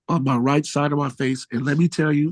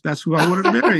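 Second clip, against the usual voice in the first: laughing; 3 dB louder, faster than usual. Real, not acted. Gushing about marrying [name].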